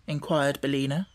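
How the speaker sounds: ordinary delivery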